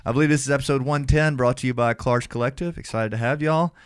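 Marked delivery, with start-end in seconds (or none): none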